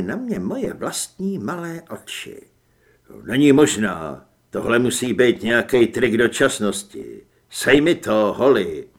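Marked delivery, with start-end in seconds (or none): 3.2-8.9 lower, louder man in disbelief; low-pitched, emphatic disbelief; hero's low character voice. Acted. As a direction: play the hero, deep and forceful.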